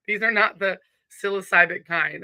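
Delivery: ordinary delivery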